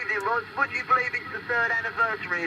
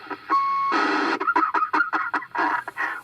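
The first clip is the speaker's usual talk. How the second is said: high, embarrassed laugh; bashful giggle; 6 dB louder than usual. Real, not acted. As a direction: giggle like you're blushing. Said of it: "I must be blushing".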